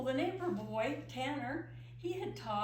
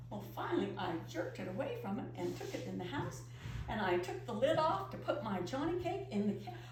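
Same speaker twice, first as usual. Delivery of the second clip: ordinary delivery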